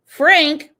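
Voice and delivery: ordinary delivery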